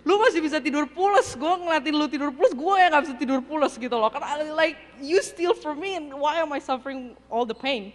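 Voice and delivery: high, loud aggrieved inner rant; angry venting. Retold or imitated. Betrayed mentor silently railing at her sleeping roommate who stole, angry. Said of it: "udah saya ada berapa hari marah-marah".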